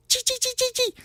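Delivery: very high, sharp little squeaks; rapid-fire taunting chirps; critter chittering. Acted. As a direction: squeak teasingly like a tiny rodent. Frosted homie squeaks at friends.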